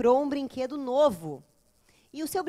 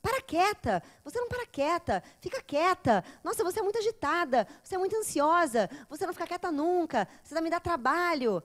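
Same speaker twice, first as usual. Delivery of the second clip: nagging scold; 4 semitones higher than usual. Retold or imitated. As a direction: nag the kid to sit still. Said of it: "ouve coisas assim do tipo".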